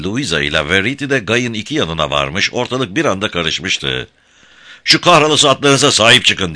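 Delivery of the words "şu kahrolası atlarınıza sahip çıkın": higher, louder irritated shout; angry yell. A narrator's reading. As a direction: shout it out, annoyed.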